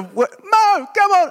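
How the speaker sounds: high loud call of a mate. Retold or imitated. Friends on the far side urging Mo on, loud high call. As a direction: beckon him across, shouting. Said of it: "these friends are".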